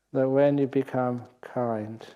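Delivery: usual speaking voice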